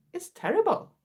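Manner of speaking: ordinary delivery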